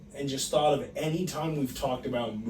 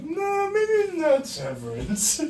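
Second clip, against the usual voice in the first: high, slow, doubting whine; doubtful whine; 4 dB louder, slower than usual. Retold or imitated. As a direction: drawl out the second guess. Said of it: "and you were like".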